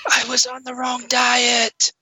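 high, slow, mournful moan; ghost voice. Pretend. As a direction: wail your cause of death like a spook. Ghost answers how it died.

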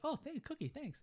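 high, soft mock-innocent voice; clueless thanks. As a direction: squeak out a small, oblivious thank-you. Will reads fortune cookie, clueless.

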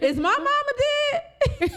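high, loud shocked cry of disbelief; shocked question. Retold or imitated. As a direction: retell the mother's sudden alarmed gasp of a question. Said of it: "she automatically went".

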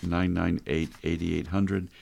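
normal talking voice

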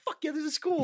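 high-pitched retold excitement; excited reaction. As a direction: act out your thrilled reaction to landing the part. Retelling his excited first reaction.

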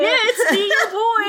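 a hammed-up cheery villain greeting; mock sweet villain. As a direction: play the creepy pal coming home. Mocking villain's fake-friendly voice.

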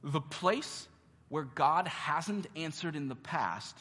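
slow, weighty and deliberate; measured preacher emphasis; slow emphatic. Shown as a demonstration. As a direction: lean on each word of the truth. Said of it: "This is a biblical truth".

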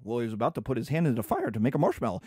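low-pitched, rapid justification; explaining uncle. Acted. Uncle justifying the smack to his brother, low hurried voice. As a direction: rattle off the excuse low and fast. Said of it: "My brother comes in and goes".

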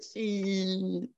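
high, soft, long-held squeal; drawn-out mock curse. Game master mimics the tree, high drawn-out cursing. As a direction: stretch the word out high and quiet. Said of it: "he's like".

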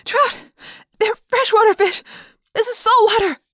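high, breathless urgent protest; panicked and out of breath; panting. A narrator's reading. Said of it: "I panted".

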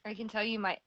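ordinary talk

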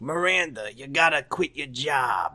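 throaty character impression, drawn out; slow, throaty put-on voice; Steve. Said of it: "it's a little throatier than Leo voice".

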